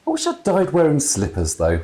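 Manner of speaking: lightly voiced jolly dad; chatty ghost father. A narrator's reading. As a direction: natter on like a jolly old man.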